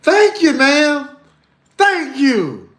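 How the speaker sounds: mama's high over-polite thanks, fading. Retold or imitated. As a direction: gush thanks to get rid of her.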